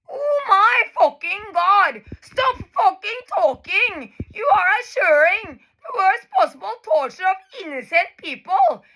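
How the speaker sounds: real tears, pleading rage; crying outburst. Real, not acted. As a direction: cry it out in anguish.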